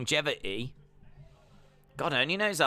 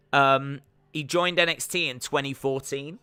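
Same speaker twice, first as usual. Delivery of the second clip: ordinary talk, nothing marked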